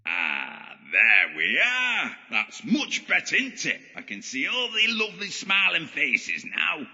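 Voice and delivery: high, gloating pantomime villain; mock delight. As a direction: gloat theatrically at captives. Villain gloats as curtains open.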